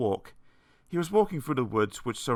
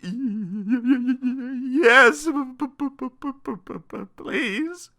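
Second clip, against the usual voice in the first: quivering stammer; 12 semitones higher, 5 dB louder than usual. A narrator's reading. Mister Jelly accepting the tramp's secret, quivering stammer. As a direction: stutter timidly until the word comes out. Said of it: "Mister Jelly quivered".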